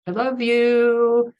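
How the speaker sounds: high, slow, drawn-out "love you"; singsong, held-out fake affection; mock-loving sign-off. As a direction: imitate a prankster's gushing goodbye. Imitating Fred's prank message sign-off.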